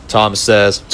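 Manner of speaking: plain reading voice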